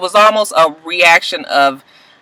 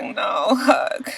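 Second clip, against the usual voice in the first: softer, breathless whisper of disbelief; shocked whisper; 11 dB quieter than usual. Real, not acted. Shocked at seeing Huck.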